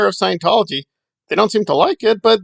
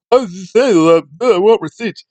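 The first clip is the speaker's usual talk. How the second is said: put-on mocking skeptic voice; mock doubter; 4 dB louder than usual. Imitating people who demand receipts, mocking. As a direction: imitate a pompous demand for proof.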